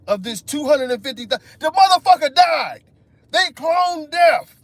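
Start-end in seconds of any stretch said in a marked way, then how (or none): 1.6-4.5 high, loud outraged yell; exasperated shout. Real, not acted. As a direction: shout it in disbelief. Ranting about dead clone.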